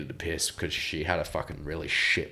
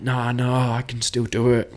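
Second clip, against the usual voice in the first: put-on higher, pleading drunk insistence; drunk protest; 6 semitones higher than usual. Drunk on the ground answering his missus, mock pleading. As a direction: insist you can still do it, a touch higher. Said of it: "and I'm like".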